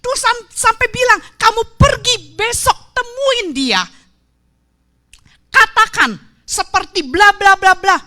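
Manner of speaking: high, loud, driving sermon; full-force preaching. Acted. Preacher proclaiming that God knows all, full-force preaching. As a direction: press it home to the crowd.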